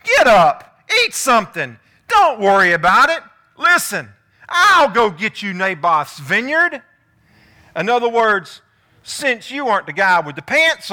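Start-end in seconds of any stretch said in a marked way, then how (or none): 0.0-6.8 loud commanding orders to her husband; loud take-charge wife ordering Ahab around; bossy demand. Retold or imitated. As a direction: take charge, barking at him. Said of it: "Jezebel demanded".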